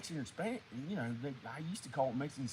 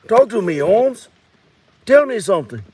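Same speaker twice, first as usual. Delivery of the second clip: loud homeboy demand; higher, loud Mexican character voice; Mexican guy character; 5 semitones higher, 23 dB louder than usual. Acted. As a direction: call out in a put-on Mexican accent. Character calls out to other man.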